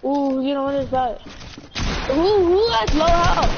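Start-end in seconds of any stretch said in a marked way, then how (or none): 2.1-3.5 slow, loud gamer callout. Real, not acted. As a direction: shout the question across the lobby. Calling out in the match.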